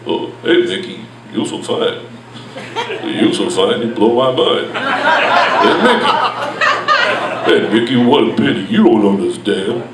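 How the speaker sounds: low, slurred mumbling tough guy; sing-talk like a mumbling boxer; Sylvester Stallone. Retold or imitated. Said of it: "Sylvester Stallone doing his favorite eighties song".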